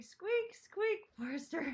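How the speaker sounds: imitating chat in a high, loud squeak. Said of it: "everyone's like".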